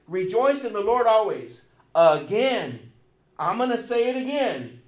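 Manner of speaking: loud preached proclamation; proclaiming loudly. Retold or imitated. Preacher quoting Paul's command, loud and proclaiming. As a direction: ring it out like a command. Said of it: "He says".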